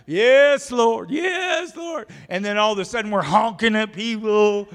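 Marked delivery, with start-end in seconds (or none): 0.1-2.1 high, loud pious "Yes, Lord"; hammed-up praise, pitched way up; mock churchy praise. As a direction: ham up churchgoer fervor. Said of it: "we curse human beings".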